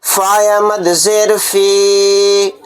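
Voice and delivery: फ़ी drawn out slowly; stretched vowel. Shown as a demonstration. Teacher demonstrating madd munfasil, slow stretched vowel. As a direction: hold the ई in फ़ी long. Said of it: "कितने अलिफ की मिकदार खींचकर पढ़ना".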